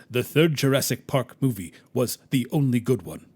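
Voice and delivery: lightly voiced solemn captain; deadpan movie verdict in a put-on captain's voice; a hint of an imitated commander; Captain Sisko. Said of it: "you can just hear Sisko's voice".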